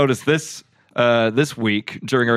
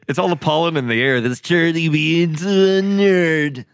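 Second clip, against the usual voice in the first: nerd voice; 5 semitones higher than usual. Acted. Mocking nerd voice.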